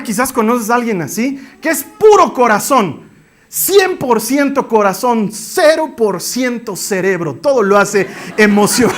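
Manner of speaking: ordinary delivery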